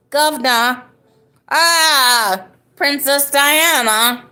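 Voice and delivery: high, loud mock-British exclamations; British accent. Shown as a demonstration. Streamer blurting stock British phrases, in a put-on British accent. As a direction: stretch it out in hammy British. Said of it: "do my best British accent".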